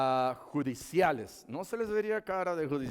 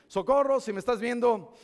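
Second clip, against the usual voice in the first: higher-pitched calling out; mock reproachful call; 6 semitones higher, 5 dB louder than usual. Preacher addresses the neighbour who never helped, mock reproach. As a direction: playfully call out someone who let you down.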